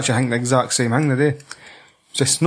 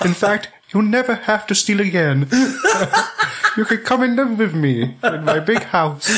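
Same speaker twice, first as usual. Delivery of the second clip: put-on higher, sweet, smug rich-boy voice; young Xavier; 8 semitones higher than usual. Mocking young Xavier inviting a kid home, higher and sweet. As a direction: offer it grandly like a posh young benefactor. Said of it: "like a young Xavier".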